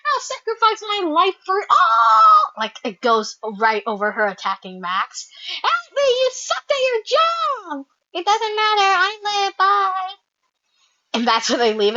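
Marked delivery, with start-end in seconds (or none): 0.1-2.5 high voice building to a shout; hammed-up self-sacrifice ending in a scream; mock heroic cry. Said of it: "it was just like". 5.6-10.2 high-pitched, hammed-up cries for help; mock frantic characters. Mimics the scene's frantic characters.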